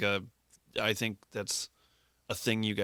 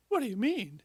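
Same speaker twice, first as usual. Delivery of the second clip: mock shocked; 12 semitones higher than usual. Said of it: "are like".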